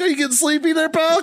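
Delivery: high, teasing jab at a tired drinking buddy; mocking taunt. Drunk Marine taunting worn-out bartenders, high mocking voice. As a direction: sing it up high like you're needling him. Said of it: "I'm still like".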